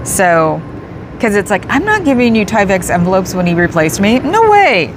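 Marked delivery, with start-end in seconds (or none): none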